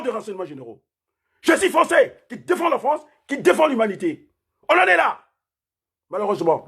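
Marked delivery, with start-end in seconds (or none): 1.4-5.2 defiant outburst. Real, not acted. Defiant outburst in live stream.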